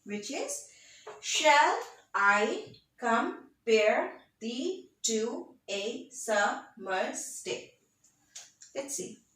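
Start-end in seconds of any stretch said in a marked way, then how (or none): 1.2-7.8 very slow, each syllable of "Shall I compare thee to a summer's day" set apart; metrical beat reading. Shown as a demonstration. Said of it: "you need to read it like this".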